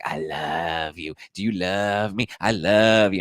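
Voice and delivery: drawn-out, put-on lovestruck voice; clingy fiancée. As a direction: mimic an overeager lover, stretching the words. Imitates clingy fiancée, eyes popping.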